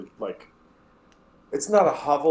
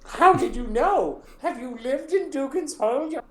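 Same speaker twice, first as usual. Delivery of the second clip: Hilda's terrible voice; 5 dB louder than usual. Acted. Hilda introducing herself to the adventurers, high terrible voice. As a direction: drag it out like a gnarled old widow.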